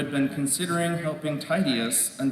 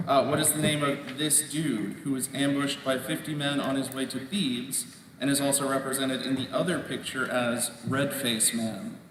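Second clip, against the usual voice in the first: ordinary talk, nothing marked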